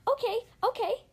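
high squeaky little mouse cry; high excited mouse call. Acted. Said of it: "the other mice cried".

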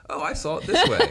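high, laughing put-on voice; laughing mock voice. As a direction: squeak it out through a laugh.

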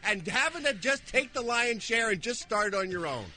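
high, sputtering indignant rant; exasperated outburst. Real, not acted. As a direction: complain bitterly at a joke-stealing partner. Co-host ranting about stolen setups.

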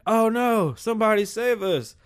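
high, hammed-up cry for help; mock panic. Townspeople in mock distress calling for help. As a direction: beg to be saved, half-joking. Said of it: "they're like".

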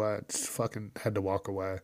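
normal talking voice

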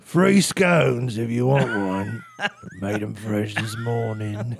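put-on broad, drawn-out English accent; hammed-up old English villager; pleasant English accent. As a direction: offer it kindly in mock English. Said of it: "that's how you say nice things to people?".

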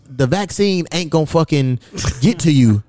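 usual speaking voice

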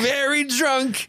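very high, drawn-out mock voice; character imitation. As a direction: stretch it out in a silly high voice. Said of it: "he's kinda like".

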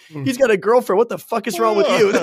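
hammed-up outraged yell, laughing; mock yell. [name] snapping back at the girl, mock yell.

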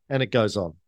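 normal talking voice

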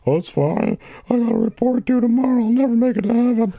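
high, whining mock sob; Weepy Voice Killer. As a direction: wail it in a hammed-up weepy falsetto. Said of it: "we have Weepy Voice Killer on the phone".

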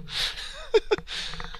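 high, soft wheezy laugh; wheezing laughter. Real, not acted. Host after joking about quitting teaching, wheezing laugh. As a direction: wheeze with laughter at your own joke.

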